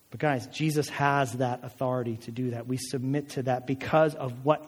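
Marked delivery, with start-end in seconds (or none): none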